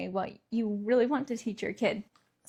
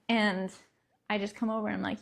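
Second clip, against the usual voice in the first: usual speaking voice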